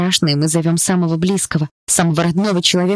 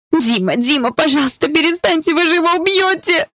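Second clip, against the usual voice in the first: pleading shout climbing ever higher; desperate scream; 12 semitones higher than usual. A narrator's reading. Girl screams at guards beating Dima, desperate pleading shout. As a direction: scream for him to stop, voice rising to breaking. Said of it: "Я кричала так громко".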